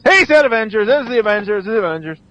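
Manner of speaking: high-pitched, loud burst of fan excitement; excited comic fan. Retold or imitated. Comic book fan spotting the Avengers tease, mock excitement. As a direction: imitate a fan blurting it out, high and eager. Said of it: "the comic book fan goes".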